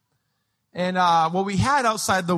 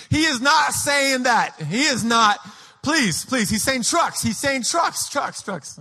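higher, pleading insistence; frantic dad protest; 6 semitones higher than usual. Retold or imitated. Dad defending his child's words to a guest, frantic protest. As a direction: protest desperately, repeating the point.

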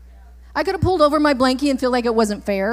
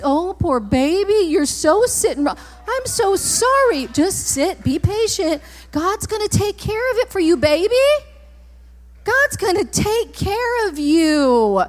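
exaggerated, drawn-out baby-soothing; mock coddling; 5 semitones higher than usual. Mocking those who pity a crying baby, syrupy fake comfort. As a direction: coo in mock sympathy, stretching the words.

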